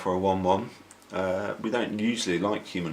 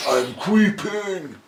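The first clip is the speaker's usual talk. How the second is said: high slow creepy creature voice; 11 semitones higher, 7 dB louder, much slower than usual. Pretend.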